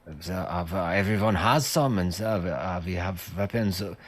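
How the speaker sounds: lightly voiced accented prisoner; a hint of a halting foreign-accented guard; hesitant captive guard. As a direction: give a reluctant, rambling answer. Plays the captive guard answering.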